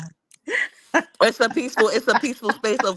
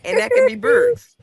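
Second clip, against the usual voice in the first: much higher, louder, slow last guess; listing possible readings; 14 semitones higher, 6 dB louder than usual. Shown as a demonstration. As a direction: stretch "and that could be birds" up high. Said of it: "That's, like, four different ways".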